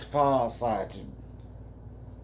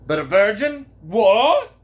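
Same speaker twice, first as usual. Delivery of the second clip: loud, high, incredulous question; hammed-up astonishment; shocked disbelief; 6 semitones higher, 9 dB louder than usual. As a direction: blurt it out, rising sharply to a loud "What?". Man's shocked reaction to her confession.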